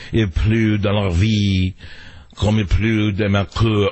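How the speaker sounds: put-on slow recital in a French accent; French-accented poetry, drawn out. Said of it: "in the French accent".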